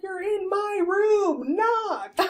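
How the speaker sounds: high, slow, drawn-out scolding; hammed-up exasperation, pitched high; mock indignation. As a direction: imitate an outraged grandpa, on the edge of laughing. Retold grandfather's angry reply.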